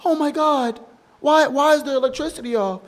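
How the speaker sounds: mock panic in a high voice; panicked wife. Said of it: "she's like".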